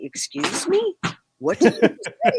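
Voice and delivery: high-pitched mock bewilderment; baffled listener. Mimics baffled locals hearing Boston talk.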